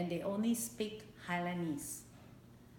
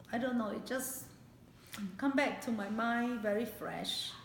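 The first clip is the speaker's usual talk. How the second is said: fighting back real tears while remembering hunger; choked up; 2 semitones higher than usual. Real, not acted. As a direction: voice breaking with real emotion. Painful memory of hunger returns.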